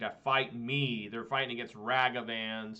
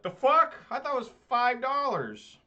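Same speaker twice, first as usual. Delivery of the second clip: high, loud disbelieving yelp; a hammed-up shriek at the price; shocked outrage; 11 semitones higher, 6 dB louder than usual. Customer yelping at the clerk over a huge price.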